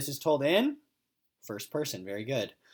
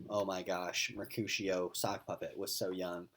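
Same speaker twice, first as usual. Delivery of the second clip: quiet aside; 6 semitones lower, 9 dB quieter than usual. Retold or imitated. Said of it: "we only hear Mister O thinking".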